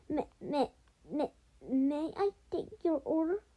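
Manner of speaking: jittery acted waiter stammering; stammered. A narrator's reading. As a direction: stumble on the first word in fright. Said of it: "he stammered".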